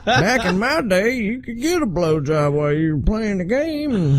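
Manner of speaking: put-on high, laughing old geezer voice; old man voice. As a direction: cackle through a cranky grandpa bit. Continues old man bit laughing.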